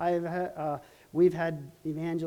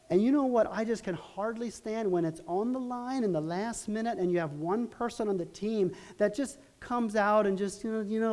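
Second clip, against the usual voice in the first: ordinary talk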